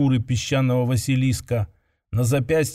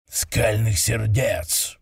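slow, low ogre bellow; roaring ogre; 3 semitones lower, much slower than usual. Acted. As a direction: drag it out like an angry ogre. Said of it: "проревел Гарн".

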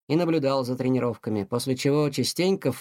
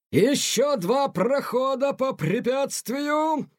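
high, slow drill-sergeant bellow; strict colonel yelling at a struggling young cat; colonel shouting; 9 semitones higher, much slower than usual. Acted. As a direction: bark the order at the exhausted cadet, slow and high. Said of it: "кричал Тихону полковник Грант".

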